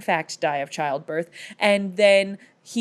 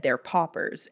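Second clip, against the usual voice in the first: ordinary talk, nothing marked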